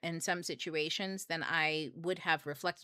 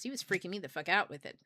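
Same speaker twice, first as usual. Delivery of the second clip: usual speaking voice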